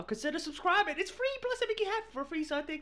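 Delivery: quick squeaky plug; high voice. Real, not acted. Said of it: "my voice is going so freaking high".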